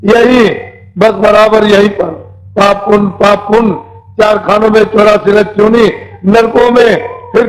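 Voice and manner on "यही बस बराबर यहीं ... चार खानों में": ordinary delivery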